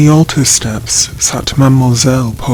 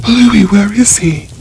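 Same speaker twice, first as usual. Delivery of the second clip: frightened question; 8 semitones higher than usual. A narrator's reading. Sister seeing the mad brother, high frightened question. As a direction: ask in rising alarm.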